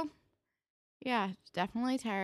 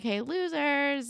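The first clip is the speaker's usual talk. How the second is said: teasing call-out; 8 semitones higher, 3 dB louder, much slower than usual. Retold or imitated. Retelling a girl calling to friends.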